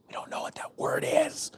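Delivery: soft, whispered imitation of puzzled clerks; baffled mock whisper. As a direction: whisper it like a stumped cashier. Mimics baffled store clerks.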